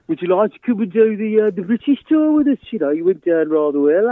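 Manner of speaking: lightly voiced band member, chummy and grand; playful put-on voice offering the tour. Quoting Slade's invitation.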